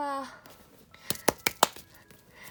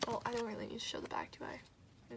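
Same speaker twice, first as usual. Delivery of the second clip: whisper; 7 dB quieter than usual. Real, not acted. Whispered aside about card back.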